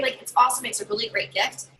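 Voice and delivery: normal talking voice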